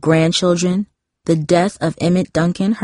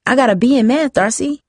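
proud announcement, pitched up; bursting in to share good news; announcing; 7 semitones higher than usual. A narrator's reading. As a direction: Jamie tells her sister about her grade, proudly. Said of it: "Jamie announced".

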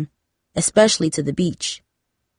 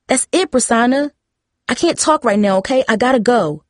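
snapped; 5 semitones higher than usual. A narrator's reading. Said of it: "Darcy snapped".

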